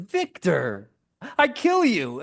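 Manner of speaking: high exclamation climbing sharply; imitated delighted recognition. Co-star realising she kills this character, imitated high delight. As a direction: exclaim it as a gleeful discovery, shooting up high.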